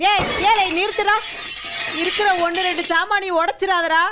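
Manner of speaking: high, urgent cry to stop; frantic, high-pitched protest; alarmed protest. Acted. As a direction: call out in alarm to halt him. Mother stops son smashing things.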